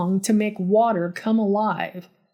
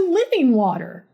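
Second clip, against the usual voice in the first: lifted, swooping sales pitch; earnest pitch; 3 semitones higher than usual. A narrator's reading. Todd pitches his magic water to a nurse, earnest pitch. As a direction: sell it with a high, falling swoop. Todd's pitch, later echoed wearily.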